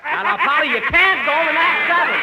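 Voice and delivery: high, loud flustered scold. Acted.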